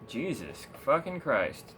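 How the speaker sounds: usual speaking voice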